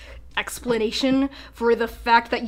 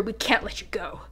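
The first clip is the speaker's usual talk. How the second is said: whispered threat; menacing whisper; 4 semitones lower, 12 dB quieter than usual. Acted. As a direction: hiss it low and final. Steward threatening intruders.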